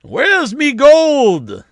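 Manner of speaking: high, drawn-out Irish leprechaun cry; bad Irish-like accent. Co-host asked for Scottish accent, comes out hammed-up Irish. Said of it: "That's Irish".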